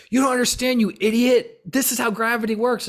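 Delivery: very high, loud mock outburst; hammed-up heated insisting; excited, worked up. Somebody insisting about gravity, over-excited and loud. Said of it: "somebody getting excited about gravity".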